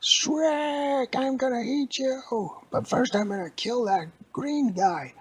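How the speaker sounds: very high, loud screeching villain; menacing old lady. Acted. Old lady threatens from her helicopter, screeching. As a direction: shriek the threat in a cartoon falsetto. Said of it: "And she was like".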